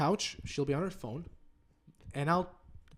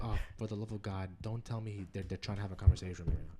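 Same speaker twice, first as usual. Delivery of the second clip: muttered inner groan; 9 semitones lower, 6 dB quieter than usual. Retold or imitated. Annoyed inner thought at dad.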